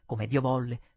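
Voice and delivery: ordinary delivery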